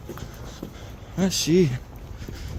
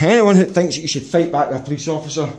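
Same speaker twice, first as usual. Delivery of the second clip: usual speaking voice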